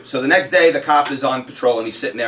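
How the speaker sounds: ordinary talk, nothing marked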